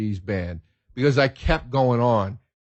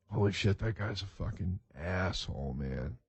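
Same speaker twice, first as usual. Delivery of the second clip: much softer, lower grumble; muttered aside; 5 semitones lower, 14 dB quieter than usual. Retold or imitated. Man to himself after meeting a rude celebrity, muttered. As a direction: say it under your breath as a private verdict.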